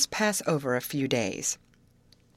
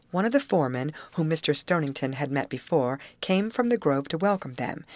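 ordinary delivery

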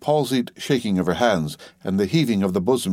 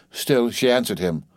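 usual speaking voice

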